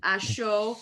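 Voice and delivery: put-on Wisconsin accent. Friend asked for a Wisconsin accent, answers in a light Wisconsin accent. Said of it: "can you give me a Wisconsin accent".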